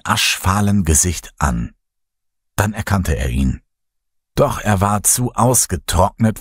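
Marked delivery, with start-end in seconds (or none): none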